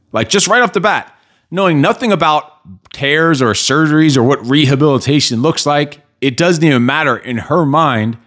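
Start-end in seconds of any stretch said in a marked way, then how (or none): none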